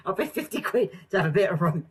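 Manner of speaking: high indignant whine; mock indignant agent. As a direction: whine about the rope. Agent still ranting.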